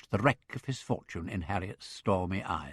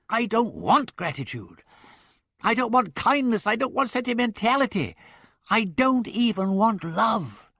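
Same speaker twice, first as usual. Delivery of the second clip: each want hit hard, rising higher and louder line by line; a man pushed past his limit, stressing want; 11 semitones higher, 5 dB louder than usual. Acted. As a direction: hammer the word want every time. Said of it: "Wimsey had reached his".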